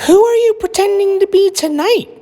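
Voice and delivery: high, bright-voiced mom; mother's voice. A narrator's reading. Mother asking Lily at dinner, voiced high. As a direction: play the amused mother.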